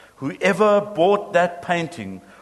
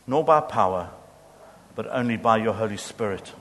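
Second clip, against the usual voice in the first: closing prayer; 2 semitones lower than usual. Real, not acted. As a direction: pray it earnestly before the congregation. Pastor leads closing prayer.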